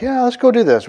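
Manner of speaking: sudden high-pitched burst of eagerness; eager agreement. Retold or imitated. King eagerly agreeing to ambush, high excited burst. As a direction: jump at the plan like a king itching to fight.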